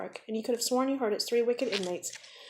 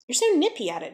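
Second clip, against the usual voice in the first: put-on higher, louder admiring boy; impressed; 6 semitones higher, 5 dB louder than usual. A narrator's reading. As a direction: marvel at his speed. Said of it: "John said".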